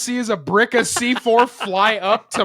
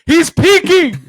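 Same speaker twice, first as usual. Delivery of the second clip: loud, high alarmed yell; 11 semitones higher, 9 dB louder than usual. Retold or imitated. Imitating a teammate warning in a shooter game, high yell. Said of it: "all I hear is Alex yell".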